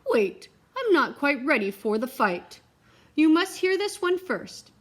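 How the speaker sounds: buy time like a nervous knight; stalling knight. Acted. The knight stalls the dragon, loud flustered plea. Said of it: "told the dragon".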